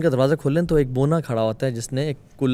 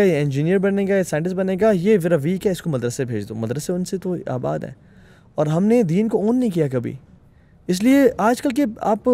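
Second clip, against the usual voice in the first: ordinary talk, nothing marked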